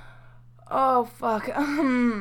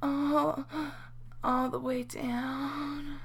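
ordinary delivery

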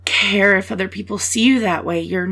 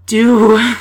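ordinary delivery

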